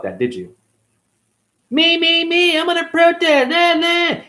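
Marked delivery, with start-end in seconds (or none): 1.7-4.2 high, whiny voice mimicking a self-absorbed protester; high mock whine at the protesters.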